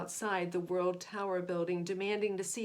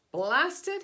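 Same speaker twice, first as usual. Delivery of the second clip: loud, high angry bellow; bellowed curse; 7 semitones higher, 9 dB louder than usual. A narrator's reading. Chief raging at his missing spacemen, bellowing. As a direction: roar it out in a rage. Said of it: "he bellowed".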